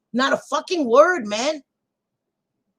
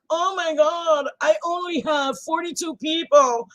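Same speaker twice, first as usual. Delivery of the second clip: higher mocking whine about viewer count; mock whiny streamer; 6 semitones higher, 4 dB louder than usual. Streamer mocks a count-obsessed creator, whiny. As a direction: mimic a petty streamer complaining. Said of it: "So I'm not like".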